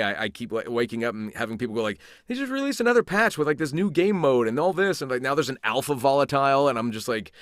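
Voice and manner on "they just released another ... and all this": high, rushed imitation of excited fans. Fans tell the actor of a new patch, excited. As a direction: relay breaking news, pitched way up then easing down.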